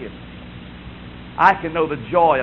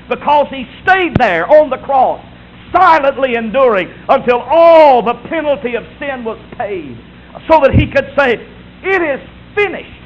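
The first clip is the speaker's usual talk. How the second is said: loud, raised, driving cadence; full-force preaching; 7 semitones higher, 7 dB louder than usual. Real, not acted. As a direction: hammer out each reason from the pulpit. Preaching climax in repeated clauses.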